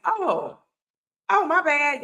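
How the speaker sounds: mock the daughter's sheepish "my bad"; little girl's apology. Daughter's reply retold on air.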